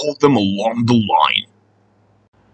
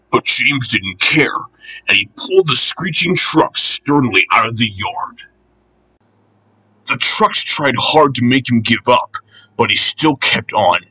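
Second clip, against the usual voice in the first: ordinary delivery, nothing marked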